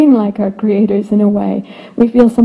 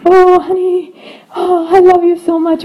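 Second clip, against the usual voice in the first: high, louder, syrupy mock-adoring parent; gushing loving parent; 8 semitones higher, 4 dB louder than usual. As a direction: coo it like a doting parent. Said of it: "like, full".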